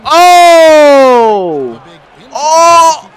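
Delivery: loud high gasping shouts with a laugh; loud hyped gamer reaction; excited shout. Real, not acted. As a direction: shout in thrilled disbelief. Reacting to near-crazy play.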